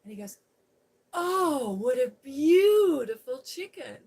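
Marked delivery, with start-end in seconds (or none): 1.1-4.0 high, loud, slow swooning over the hen; loud high-pitched awestruck admiration; gushing over a chicken. Retold or imitated. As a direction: gush like a big manly man smitten by a chicken. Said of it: "he's gushing over the chicken".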